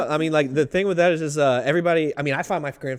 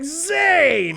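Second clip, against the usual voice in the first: a high, loud call of recognition; happy shouted name; 4 dB louder than usual. Retold or imitated. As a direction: yell it like spotting an old buddy. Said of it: "hick talking back and forth".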